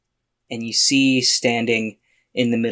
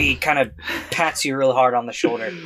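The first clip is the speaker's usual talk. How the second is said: usual speaking voice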